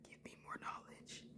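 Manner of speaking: whispered greedy aside; hushed whisper. Real, not acted. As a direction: breathe it like a secret craving.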